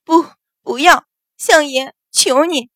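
high-pitched, urgent begging; frightened pleading. A narrator's reading. Concubine begging the master to stop the beating, frightened pleading. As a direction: beg in fright, breaking it into short cries. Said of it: "连声道".

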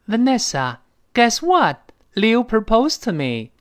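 put-on high, drawn-out, bubbling girlish news; excited announcement. Girl telling her best friend Leo proposed, excitedly.

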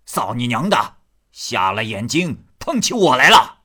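put-on loud abusive curse from a drunk; drunken cursing. Drunk Ni Er curses the man who bumped him, loud and abusive. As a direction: snarl at the clumsy stranger. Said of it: "听那醉汉骂道".

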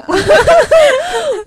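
loud high laugh at the self-confessed fan; high burst of laughter. Real, not acted. Fan gushing over Shu Qi.

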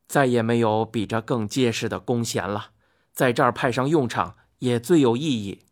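a lightly voiced, low-pitched husband; placating husband. A narrator's reading. Hou Yi excuses himself to his wife, mildly placating. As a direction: offer a mild, apologetic excuse. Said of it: "后羿陪笑道说".

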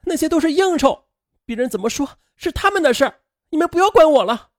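high, loud protest from an upset daughter; defiant outburst. Acted. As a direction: argue back at your parents, pitched high and loud. Daughter defying parents in acted quarrel. (高声顶撞父母)

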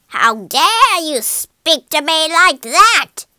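loud, high, drawn-out witch fury; witch's snarl. A narrator's reading. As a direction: snarl it slowly as an outraged witch. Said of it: "snarled the witch".

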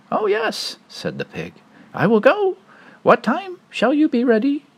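very high pig voice; little pig voice. Acted. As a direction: answer brightly up high.

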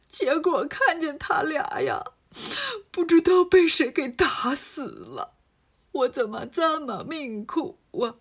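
slow, put-on sobbing old man lament; fake crying. Disguised demon to Monkey King, slow fake crying lament. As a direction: wail it like a grieving old man who is faking it. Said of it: "别装了".

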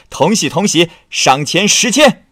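tipsy master shouting to the messengers; elated shout. Acted. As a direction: boom out the reward, savouring it. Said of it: "起来喊道".

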